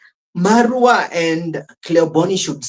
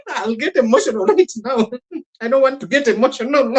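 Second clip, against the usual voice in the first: giggling mock-emotional voice, higher and fast; mocking Cleoboni; 7 semitones higher, faster than usual. Mimicking Cleoboni dodging the email question, laughing. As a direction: play her up as a teary guest, laughing through it. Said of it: "And Cleoboni was like".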